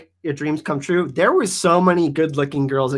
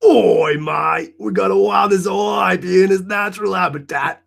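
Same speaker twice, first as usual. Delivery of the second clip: hammed-up Australian wildlife-host bit; bad Australian accent; 3 semitones higher than usual. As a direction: horrible fake Australian accent, yelled. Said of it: "That accent was horrific".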